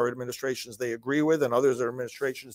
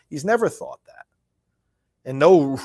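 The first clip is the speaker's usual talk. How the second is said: usual speaking voice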